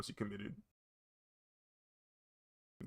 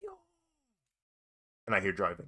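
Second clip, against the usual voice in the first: usual speaking voice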